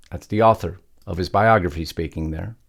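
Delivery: usual speaking voice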